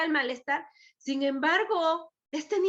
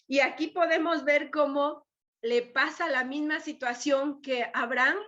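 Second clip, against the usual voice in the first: usual speaking voice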